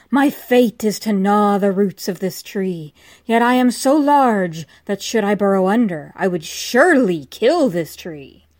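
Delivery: loud anguished outcry; crying out. Acted. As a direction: cry it out loud in despair. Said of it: "cried the serpent".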